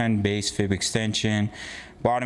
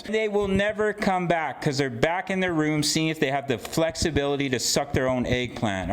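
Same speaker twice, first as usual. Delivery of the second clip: ordinary talk, nothing marked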